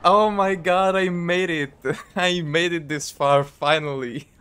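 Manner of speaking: high, loud, overjoyed; ecstatic. Real, not acted. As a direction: near tears with joy. Boss finally beaten.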